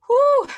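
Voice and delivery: loud high whew of relief; relieved whew. Real, not acted. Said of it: "Okay, I'm doing it".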